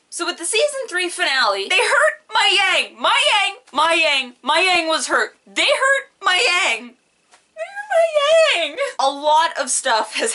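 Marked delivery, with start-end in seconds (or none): 1.7-8.8 high, loud anguished cries; outraged wail. Real, not acted. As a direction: cry out in fan outrage. Fan outraged over character.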